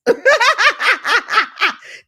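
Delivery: big shrieking laugh; loud high laugh. Real, not acted. Coach laughs out loud at the five million goal, shrieking. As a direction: burst out laughing hard and high.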